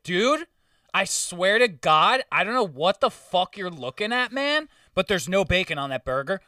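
loud, high start, then insistent; exasperated retort. Retold or imitated. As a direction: plead your case like a fed-up waiter. Retold waiter's exasperated argument with customer.

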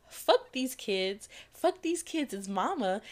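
each word dragged out and hit hard; slow, deliberate cursing; slow emphatic curse. Acted. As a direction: spit it out slowly, word by word. Spelling out her bitter mentality.

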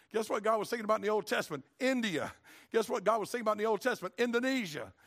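preacher driving a repeated question home; preaching refrain. Acted. Building refrain litany.

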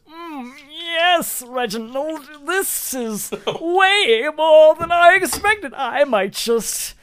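very high, slow, drawn-out swoon; swooning husband. Acted. Husband to Reginald at the match, overcome with excitement. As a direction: wail it like you are about to faint. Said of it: "he faints from excitement".

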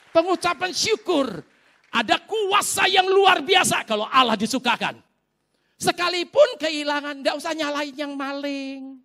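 high, impassioned exhortation; full-force preaching. Real, not acted. Preacher exhorting congregation to give thanks, impassioned high preaching.

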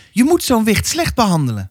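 put-on high, pressing old woman's voice; stern old neighbour. Old neighbour warning the mother about her child, high and pressing. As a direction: lean in like a suspicious village gossip.

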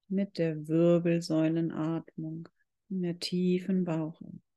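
ordinary talk, nothing marked

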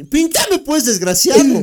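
high, loud hammed-up rage with a laugh; mock furious girlfriend. Girlfriend demanding her boyfriend's phone, a hammed-up shout. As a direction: yell it like a jealous partner half laughing.

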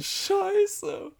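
very high kawaii squeak; high cutesy voice. Pretend. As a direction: squeak it like an anime fan. Mock anime squeals.

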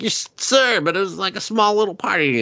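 high, lightly voiced nervous excuse; nervous soldier. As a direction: squeak out the excuse to the king. Voicing the reporting soldier.

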